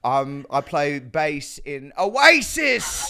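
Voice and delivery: put-on halting, then slow loud English accent; Rebel Wilson, English accent. Guest improvising as the co-star, hammed-up English accent. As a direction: ham up a failed Australian as English. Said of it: "you're fully doing English".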